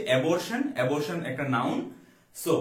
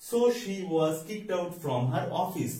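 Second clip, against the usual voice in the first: slow dictation; much slower than usual. Shown as a demonstration. Teacher reads example sentence slowly.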